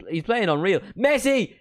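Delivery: rising to a high, loud shout; excited match call. Real, not acted. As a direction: shout the player's name as he shoots. Live call of an attack.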